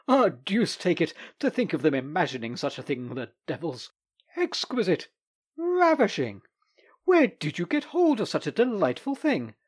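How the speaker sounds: high, rising, rapturous exclamations; delighted. Acted. The lawyer admiring the bronze, rapturous and high. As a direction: gush over it in ecstasy. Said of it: "moved to indescribable delight".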